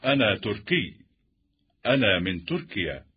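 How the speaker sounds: ordinary talk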